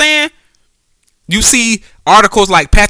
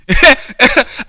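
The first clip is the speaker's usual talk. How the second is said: short higher-pitched chuckle; laugh; 7 semitones higher than usual. Real, not acted. Fan amused by his own protest, chuckling. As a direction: laugh briefly at your own rant.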